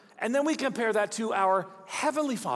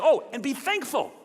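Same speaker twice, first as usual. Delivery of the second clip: "Oh, and be thankful" pitched up with emphasis; higher, punchy "be thankful"; emphatic; 6 semitones higher, 4 dB louder than usual. Acted. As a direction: exclaim "be thankful" as an emphatic add-on. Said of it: "It's an emphatic term".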